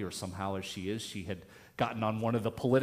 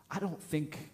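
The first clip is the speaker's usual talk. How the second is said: imitating a grandmother's Southern accent, pitched up; higher-voiced Southern grandmother, retold; deep Southern accent; 6 semitones higher than usual. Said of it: "deep Southern accent".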